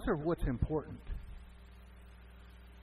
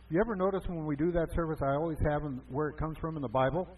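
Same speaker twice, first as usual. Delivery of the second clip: ordinary talk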